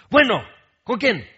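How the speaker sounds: loud, high, impatient bark into the phone; mom's rude phone answer. Retold or imitated. Mom mid-scolding answering the phone, loud and impatient. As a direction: snap at the caller like an annoyed mom. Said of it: "y ella, ¿cómo contesta?".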